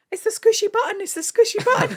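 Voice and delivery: put-on very high, squeaky little-kid voice; high playful child voice; imitating a toddler. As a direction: chirp it like an excited toddler. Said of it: "she was, like, going, like".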